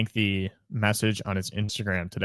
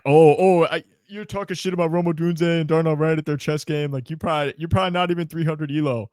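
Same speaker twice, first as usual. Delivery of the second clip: higher-pitched mocking commenter voice; put-on heckler, higher than usual; mock online commenters; 7 semitones higher than usual. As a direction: imitate an indignant commenter, pitched up. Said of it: "being like".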